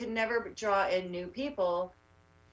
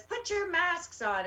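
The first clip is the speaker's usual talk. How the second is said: high, drawn-out mock yell without the volume; imitated yell; 9 semitones higher, slower than usual. Activist retells kids yelling at her group, mock yell. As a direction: call it out high and slow, imitating hecklers. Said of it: "they're yelling at us".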